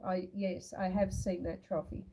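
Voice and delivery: ordinary talk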